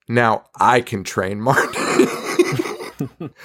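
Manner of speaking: slow, drawn-out "now I can train Marnie"; smug mom. Retold or imitated. Mom claiming the daughter from grandma, slow smug voice. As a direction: savor every word, mock-possessive. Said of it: "And the mom's like".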